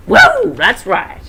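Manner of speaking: loud high whoop of victory; triumphant shout. Acted. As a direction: cheer like you just won. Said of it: "shouted Mike".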